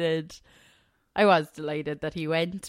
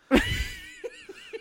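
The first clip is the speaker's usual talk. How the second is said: high burst of giggling; high laugh; 3 dB quieter than usual. Real, not acted. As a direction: giggle helplessly. Laughing at mispronunciation.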